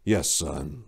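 a hint of a tired father. Voices the father.

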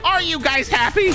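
high-pitched mock exasperation; exasperated. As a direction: throw it at the audience, fed up. Exasperated, asks the viewers.

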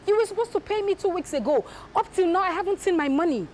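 loud, high angry demand. Acted. Wife on phone with Jude, angrily demanding her money. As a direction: raise your voice, you've been waiting two weeks.

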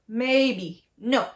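level "Maybe" into emphatic falling "no"; first-fourth tone model. Shown as a demonstration. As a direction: keep "Maybe" even and drop "no" sharply. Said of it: "First and the fourth".

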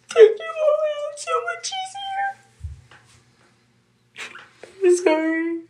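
crying through the words as she retells it; real crying. Real, not acted. Mother recounting her son's threats to kill them, really crying.

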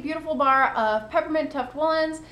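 usual speaking voice

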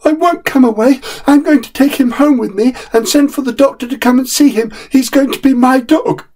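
put-on a small boy refusing his nurse; stubborn little boy.

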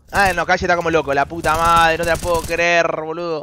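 ordinary talk, nothing marked

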